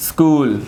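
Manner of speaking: स्कूल in a single beat, skool; one unbroken syllable. Shown as a demonstration. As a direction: say स्कूल with no break inside. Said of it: "जिसमें break नहीं आ रही".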